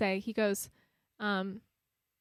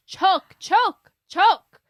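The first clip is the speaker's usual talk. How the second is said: high, barked repeated command; imitating an insistent director at full volume; louder and louder; 10 semitones higher, 17 dB louder, much slower than usual. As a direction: shout each word as a separate order. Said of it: "kept saying it louder and louder".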